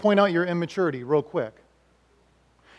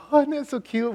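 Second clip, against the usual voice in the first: doting parent; 7 semitones higher than usual. Retold or imitated. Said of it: "we'd be like".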